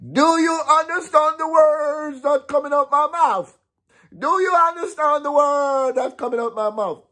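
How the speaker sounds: high-pitched squeaky Chris Tucker voice, way up; Chris Tucker impression. Vlogger mocking the coach, in a high Chris Tucker voice. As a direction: shriek it like Chris Tucker in Rush Hour. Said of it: "in a Chris Tucker voice".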